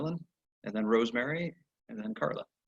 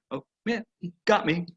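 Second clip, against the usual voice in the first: sheepish; 7 semitones higher than usual. Retold or imitated. Caught worker sheepishly admits.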